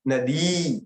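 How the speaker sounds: नदी with the final ई held long; long final vowel. Shown as a demonstration. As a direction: draw out the end of नदी. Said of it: "दीर्घ ई रखी". (नदी के अंत में लंबी ई)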